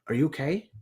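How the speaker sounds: soft, concerned question; a leader giving a peer the benefit of the doubt, softly; empathetic. Shown as a demonstration. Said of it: "have empathy ... and say".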